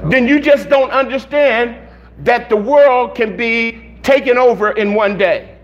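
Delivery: ordinary talk, nothing marked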